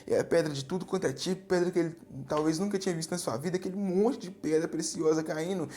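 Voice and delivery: ordinary talk, nothing marked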